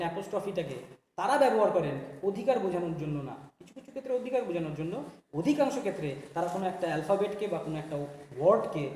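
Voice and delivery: normal talking voice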